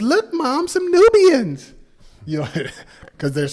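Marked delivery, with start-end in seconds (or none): 0.0-1.7 imitating a kid, pitched very high; mock little-boy squeal, high and drawn out at the end. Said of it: "one of the little boys says".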